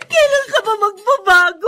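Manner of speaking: a tearful cry of despair; crying outburst. Acted. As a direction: cry it out at him. Wife crying out in quarrel. (umiiyak na sigaw sa asawa)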